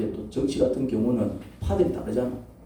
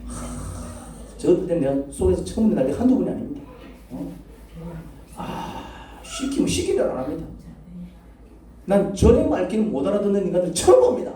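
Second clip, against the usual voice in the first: exasperated complaint; 6 semitones higher than usual. Acted. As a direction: act out a fed-up pastor complaining. Acted angry complaint to God. (답답해서 하소연하듯 점점 높여서)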